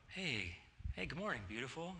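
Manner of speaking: soft, gentle morning greeting; tender greeting. Retold or imitated. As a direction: greet her softly.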